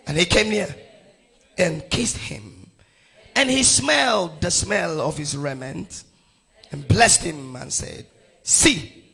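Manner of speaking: emphatic, measured Bible reading; scripture reading. Acted. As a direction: proclaim the verse phrase by phrase. Reads scripture aloud to congregation.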